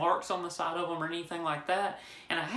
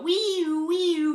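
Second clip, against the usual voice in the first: loud, high wee-oo siren; mock siren, high and loud; imitated police siren; 9 semitones higher, 8 dB louder than usual. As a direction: wail it out like an arriving squad car.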